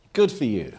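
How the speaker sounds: high, loud, gushing approval; mock applause. Speaker voicing a cheering crowd to the audience, high gushing approval. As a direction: gush over it like an eager fan. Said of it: "The unsupportable phase is".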